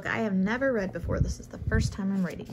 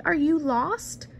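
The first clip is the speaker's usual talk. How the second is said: higher, slow sweet-talk voice; cooing lady; 7 semitones higher, slower than usual. A narrator's reading. Lady cooing at a kitty cat, higher and slow. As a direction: coo at a lost kitty, slowly and high.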